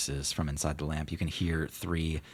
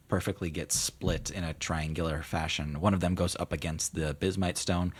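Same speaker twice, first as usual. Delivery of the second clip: ordinary talk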